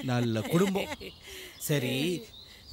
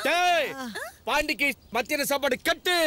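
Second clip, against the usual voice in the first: high-pitched stern order; commanding call; 6 semitones higher than usual. Acted. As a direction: call out a threat to make him obey. Threatens to cut his lunch.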